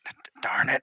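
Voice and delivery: whispered, stammering curse; stuttering whisper. Acted. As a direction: breathe the stammer out under your breath. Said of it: "she kinda just goes".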